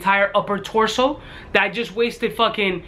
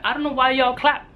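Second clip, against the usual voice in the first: ordinary delivery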